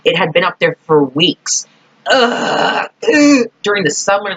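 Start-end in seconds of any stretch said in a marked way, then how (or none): 2.1-3.5 gag out a grossed-out ugh; retching ugh at the memory; disgusted groan. Retold or imitated. Disgust at the moldy hot dog.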